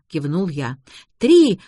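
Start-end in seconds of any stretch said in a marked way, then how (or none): 1.2-1.6 high, loud shouted count; piercingly higher yell; girl's holler. A narrator's reading. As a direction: holler the cue to push. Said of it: "заорала девушка".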